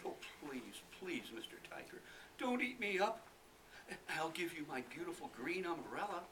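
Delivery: lightly voiced high begging boy; pleading little boy. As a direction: beg the tiger sweetly.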